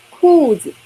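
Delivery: 裤子 for students to repeat; model for repeating. Shown as a demonstration. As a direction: lead the echo: 裤子. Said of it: "Okay, read after me".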